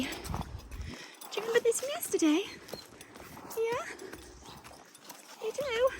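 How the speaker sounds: high, soft, slow pet talk. Real, not acted. Rider softly asks her horse if she remembers, high baby talk.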